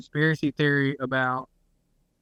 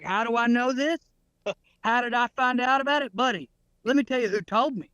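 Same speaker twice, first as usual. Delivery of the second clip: higher-pitched, self-sure explainer; 8 semitones higher than usual. Retold or imitated. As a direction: mimic a man proudly citing his sources. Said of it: "He was like".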